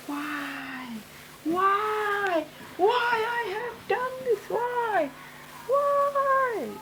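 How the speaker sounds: high, strained wailing why; screaming at the sky in despair; anguished high cry. Acted. Boy screaming after the villain escapes, high anguished wail.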